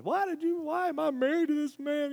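high pleading grandmother voice; crying out to God. Retold or imitated. Grandmother crying out to God, high and pleading.

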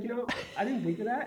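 high, startled realization; surprised. Retold or imitated. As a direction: caught off guard, pitch jumps up. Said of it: "and I was like".

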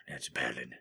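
whispering. Acted. Whispered aside.

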